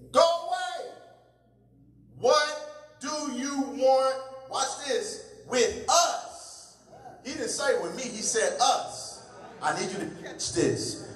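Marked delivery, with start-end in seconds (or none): 0.1-6.8 possessed man screaming at Jesus; demon crying out. A narrator's reading. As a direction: shout it out at the top of the voice. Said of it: "He cried out at the top of his voice".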